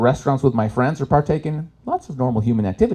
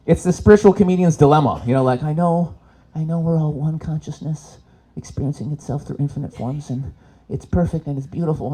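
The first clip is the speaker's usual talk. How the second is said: ordinary delivery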